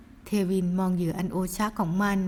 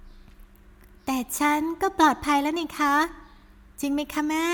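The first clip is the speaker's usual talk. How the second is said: put-on higher, slow girlish voice; sweet daughter; 6 semitones higher, much slower than usual. Daughter reassures her mother, higher and slow. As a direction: coax mother gently, high.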